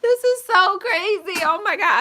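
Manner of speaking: exasperated outburst; frustrated and high. Real, not acted. Struggling to set up the camera stand.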